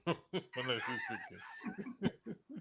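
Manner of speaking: laughing it off; chuckling through the words. Real, not acted. Laughs at own rambling.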